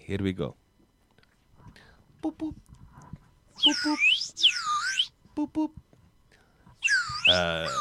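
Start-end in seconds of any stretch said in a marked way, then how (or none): 2.2-5.7 high squeaky beeping noises; mock machine beeps. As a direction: beep like a lab machine. Machine beeps in lab scene.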